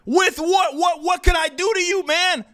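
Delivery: high, baffled pleading; incredulous protest. Acted. Streamer asking his accuser what he could do, incredulous protest.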